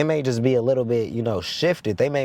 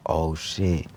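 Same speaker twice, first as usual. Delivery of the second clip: awed oh shit; 8 semitones lower than usual. Retold or imitated. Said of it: "and I was like".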